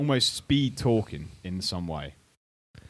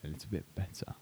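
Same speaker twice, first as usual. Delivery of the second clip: slow, deliberately reined-in speech; controlled; 4 dB quieter than usual. Real, not acted. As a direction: hold the words back, taking your time. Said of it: "trying to control myself".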